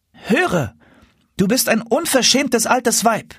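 put-on high-pitched cross little boy; indignant boy. A narrator's reading. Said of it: "rief er unmutig". (unmutig rufender kleiner Junge, hohe Stimme)

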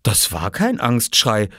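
plain reading voice

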